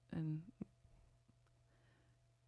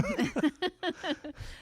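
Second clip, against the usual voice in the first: high laugh; 11 semitones higher, 7 dB louder than usual. Real, not acted. Laugh at hard question.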